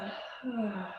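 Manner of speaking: long, soft falling sigh; demonstrative exhale for meditation; audible exhale. Shown as a demonstration. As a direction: let the breath out in a soft sigh. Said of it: "then exhale".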